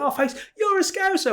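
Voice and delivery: high, shouted southerner voice; mock the southern dad; imitating a southern tourist. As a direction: southerner in Mallorca mistaking him for a Scouser. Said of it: "He went".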